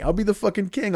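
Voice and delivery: ordinary talk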